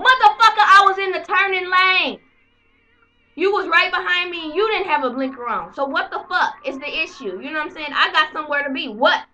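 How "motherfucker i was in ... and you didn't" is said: loud, heated comeback at the cop; furious yell at the officer; angry retort. Retold or imitated. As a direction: shout it back in outrage. Arguing back at the cop.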